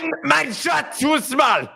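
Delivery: high, slow, sung-out mock German accent; fake German accent. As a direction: belt it like a cartoon German. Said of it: "the German accent before".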